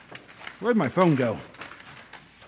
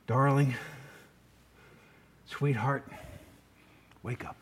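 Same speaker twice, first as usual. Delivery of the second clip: tender waking call; 5 semitones lower, 7 dB quieter than usual. Acted. Jesus gently waking the girl.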